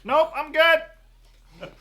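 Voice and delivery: high, loud, drawn-out refusal; calling back. Acted. Terry refuses to come inside, calling back high. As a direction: holler a slow refusal from afar.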